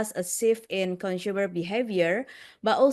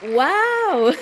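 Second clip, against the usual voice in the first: excited high loud wow; hyped giveaway announcement; 6 semitones higher, 7 dB louder than usual. Real, not acted. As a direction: burst out as the winner lands. Giveaway winner revealed.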